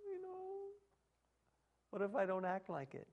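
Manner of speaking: high, soft whine of a doubter; whiny objecting listener. Retold or imitated. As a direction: squeak out the excuse in a small high voice. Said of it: "You say".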